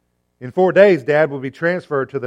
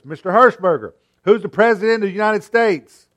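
higher, louder nurse repeating the question; raised voice; 8 semitones higher, 7 dB louder than usual. A narrator's reading. Nurse repeats her question to drowsy Dad, raised voice. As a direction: push it out so he has to answer. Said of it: "She raised her voice".

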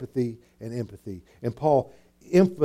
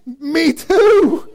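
much higher and louder, laughing; excited agreement; 13 semitones higher, 8 dB louder than usual. Acted. Preacher playing a parent who also yelled at his kids, laughing. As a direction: blurt it out delighted. Mock reply, church drive yelling.

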